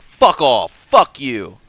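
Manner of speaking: mock furious, bitten off hard; retold angry outburst. Friend telling streamer off, loud imitated anger. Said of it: "And she's like".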